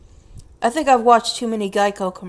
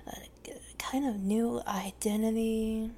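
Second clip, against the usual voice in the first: musing whisper; 11 dB quieter than usual. Real, not acted. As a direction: whisper the question to yourself. Whispered musing repeat of question.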